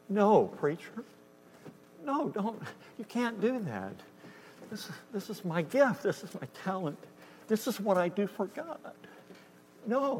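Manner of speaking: high, pleading whine; high-pitched wailing plea; whiny pleading protest. Retold or imitated. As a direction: beg the preacher not to take it away. Piano player begs to keep playing.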